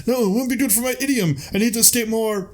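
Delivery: high, grand theatrical knight voice; haughty Lancelot. Retold or imitated. Lancelot refusing the minstrel's exit, high grand voice. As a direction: play a vain knight refusing. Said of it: "And Lancelot's like".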